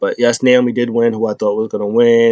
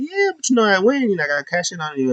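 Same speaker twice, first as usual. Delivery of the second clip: mock high voice for a retold brag; high-pitched mimicry; 9 semitones higher, 3 dB quieter, faster than usual. Retelling a backstage boast to viewers, high mock voice. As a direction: toss it off high, imitating a wrestler.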